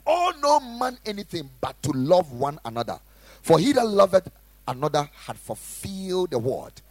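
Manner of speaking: loud, high, fervent sermon delivery; full-force preaching. Acted. Pastor exhorting the congregation, loud fervent preaching. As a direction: preach it hard to the room. Quoting scripture loudly.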